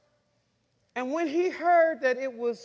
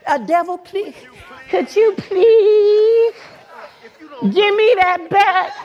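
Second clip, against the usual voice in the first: loud, high, drawn-out begging; hammed-up pleading to the devil; mock pleading; 7 semitones higher, 13 dB louder, slower than usual. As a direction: beg with a hugely stretched "please". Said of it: "You don't go up to the devil and say".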